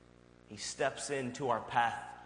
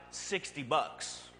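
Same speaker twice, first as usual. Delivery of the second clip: ordinary talk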